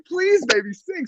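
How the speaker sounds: high-pitched, soft coaxing plea; playful pleading. Retold or imitated. Husband begging his singer wife to perform, pleading.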